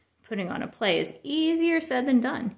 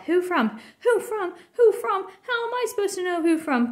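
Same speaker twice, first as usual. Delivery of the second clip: high, loud repeated whine; exasperated mock parent; 8 semitones higher, 4 dB louder than usual. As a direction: play it up, fed up. Cub mocking parent on phone.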